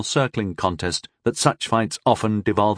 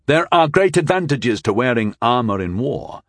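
ordinary delivery, nothing marked

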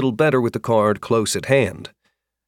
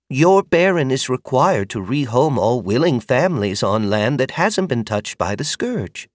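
higher, careful motherly voice; kindly older woman; 4 semitones higher than usual. Acted. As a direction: explain patiently. Magda explains rehoming.

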